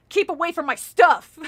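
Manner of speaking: loud, sharp warning; angry shout. Acted. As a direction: yell it as a threat. Counselor yells in story.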